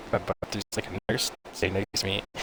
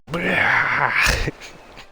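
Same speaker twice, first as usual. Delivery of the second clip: loud, drawn-out mock vomit noise; imitated retching; 7 semitones higher, 5 dB louder than usual. Patient as the bag hits his lips, imitated retching. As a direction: heave out an exaggerated puking sound.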